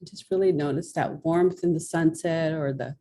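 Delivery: soothing guided imagery; guided visualization. Acted. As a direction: lead her gently into a calm memory.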